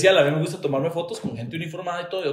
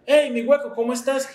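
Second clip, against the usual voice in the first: cheerful greeting; 10 semitones higher than usual. Retold or imitated. Friendly greeting retold.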